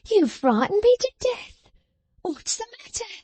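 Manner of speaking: high, hammed-up mock surprise; affected astonishment. A narrator's reading. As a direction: fake a gasp of shock. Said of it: "in affected astonishment".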